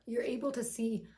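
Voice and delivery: normal talking voice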